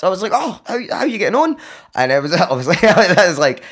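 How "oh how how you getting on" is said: stammered high-pitched greeting; mock startled hello; shocked. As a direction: act caught off guard, voice jumping up. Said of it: "Like, I was shocked".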